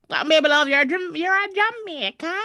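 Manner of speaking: high-pitched, clumsy attempt at Jamaican; bad Jamaican accent. Host riffing for viewers in a badly faked Jamaican accent. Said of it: "I can't do the Jamaican accent".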